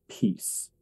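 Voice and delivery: usual speaking voice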